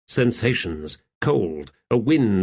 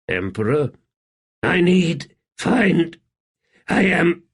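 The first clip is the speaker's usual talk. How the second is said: tormented emperor; 4 semitones higher than usual. Acted. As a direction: gasp out the words in anguish. Rulad voiced, broken and torn.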